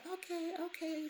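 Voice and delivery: put-on high little-girl okay; lightly voiced granddaughter; child agreeing. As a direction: answer like a small child. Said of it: "And she said".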